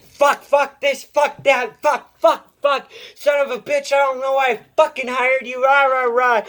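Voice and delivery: hammed-up imitation of a raging boss swearing at the broken strap; ranting boss. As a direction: mimic the boss blowing up, pitch way up. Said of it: "he's yelling at me".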